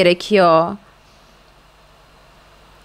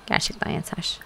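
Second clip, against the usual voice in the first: unvoiced fed-up whisper; 12 dB quieter than usual. Real, not acted. Player grumbling at her Sims, soft whisper. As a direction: hiss it under your breath.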